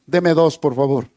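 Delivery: low, offhand buyer's reply; lightly acted matter-of-fact customer; casual shopper. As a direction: order it casually, dropping low. Acted shopper buys without hesitation.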